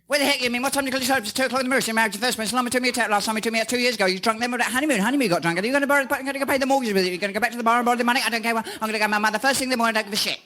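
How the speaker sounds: high, fast scolding wife; nagging wife. Retold or imitated. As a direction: rattle it off like a furious wife. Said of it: "it'll sound something like this".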